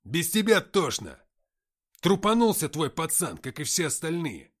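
loud, harsh furious yelling; angry shout. Acted. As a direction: roar at her in contempt. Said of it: "рявкнул Антоха".

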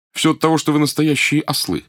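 sharp, emphatic rebuke; scolding. A narrator's reading. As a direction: scold them like fools. Doctor scolds the pirates.

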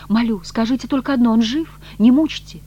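high, loud urgent begging; implore, rising to a cry; desperate pleading. A narrator's reading. Woman begging to know he lives, desperately. Begging for news of her lover.

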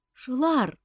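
timid, high-pitched call. A narrator's reading. Young prince timidly calling his cousin the king, timid. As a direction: speak up hesitantly in a high voice. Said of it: "робко подал голос Мафей".

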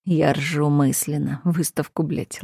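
usual speaking voice

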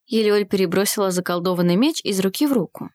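ordinary delivery

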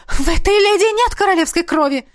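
high, stammering protest; distraught high-pitched objection; hysterical wail. A narrator's reading. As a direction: wail frantically in denial. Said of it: "вновь взвыла блондинка".

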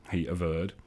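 ordinary delivery

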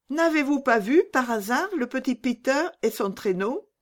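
put-on high, searching child's question; hopeful little Gerda. Gerda asking the pigeons about Peter, high and hopeful. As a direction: ask in a high little-girl voice.

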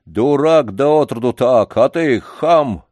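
loud, slow furious cursing; old man swearing at the janitor, loud. Acted. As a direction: hurl the insults at him. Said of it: "выругался Лодыжкин".

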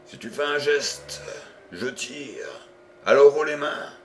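loud command, last words shouted; armed threat. A narrator's reading. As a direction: hold him at gunpoint, bark it. Detective threatens with gun. (menace armée, ordre crié)

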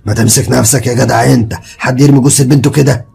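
loud, gruff rebuke; angry scolding. Acted. Gravedigger scolding the father for dropping the body, angry. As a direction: tell him off sharply. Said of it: "اتعصب عليا".

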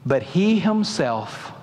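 "But he himself" drawn out slowly; slow emphasis. Acted. Preacher stressing Jacob going first, very slow. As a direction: lean on "he himself" with slow weight. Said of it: "listen to what's being said here".

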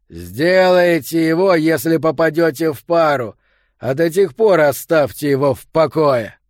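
loud, harsh scolding; angry shout. A narrator's reading. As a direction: yell at them to back off. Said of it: "крикнул Йо".

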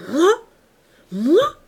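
high, loud, outraged cry. A narrator's reading. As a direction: fling the word back, pushed to the limit. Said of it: "cria la jeune femme poussée à bout".

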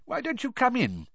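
high-pitched, genial invitation; twinkling old headmaster. A narrator's reading. Dumbledore inviting the students inside, genial. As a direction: invite them in kindly. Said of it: "his eyes twinkling".

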